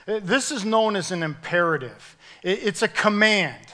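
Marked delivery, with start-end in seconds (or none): none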